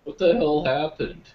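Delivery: slow, high, loud shocked exclamation. Retold or imitated. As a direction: gasp it out in disbelief. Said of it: "I'm like".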